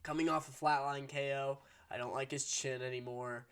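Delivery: ordinary delivery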